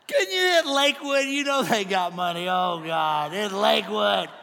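loud wail sinking into a moan; mock pitiful moan. Pastor grumbling God spared Lakewood, mock pitiful moan.

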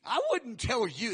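a little sarcasm, playing God; measured, pointed sarcastic retort; divine sarcasm. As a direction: drily put the givers in their place. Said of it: "this is a little sarcasm".

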